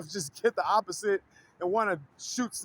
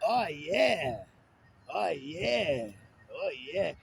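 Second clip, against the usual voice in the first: lower, softer lusty Scottish grunt; Fat Bastard; 6 semitones lower, 4 dB quieter, much slower than usual. Retold or imitated. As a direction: growl it low like Fat Bastard. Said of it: "I could do Fat Bastard. Watch this".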